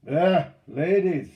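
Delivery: slow, loud, high catcall; mocking call. A narrator's reading. Bellegarde taunts the unloading crew, calling out. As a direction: stretch out the taunt. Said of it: "he called out as they unloaded".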